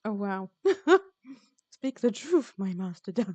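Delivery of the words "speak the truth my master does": a hint of Yoda, louder; clumsy mock Yoda line; bad Yoda impression. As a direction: toss off a rough Yoda voice. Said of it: "That was a bad impression".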